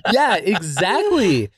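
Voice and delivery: laughing through it; slow, laughing agreement. Real, not acted. Laughing after joke.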